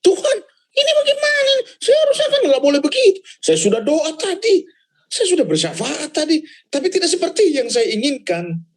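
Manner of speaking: high, pleading cry to God; panicked plea to God. Retold or imitated. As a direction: beg God high and desperate. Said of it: "Terus hari itu saya nggak ada duit". (doa panik dengan suara tinggi memelas)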